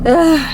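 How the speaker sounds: loud high groan of disgust; disgusted ugh. Real, not acted. Vlogger recalling food poisoning, loud ugh of disgust. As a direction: let out a loud grossed-out ugh.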